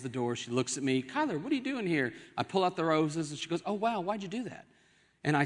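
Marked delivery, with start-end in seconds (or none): 1.0-2.1 surprised wife. Acted. Wife voiced at the door. 3.6-4.7 higher, lighter imitated wife; acted pleasant surprise; softly amazed, voice lifted; surprised wife. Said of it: "she's shocked and surprised".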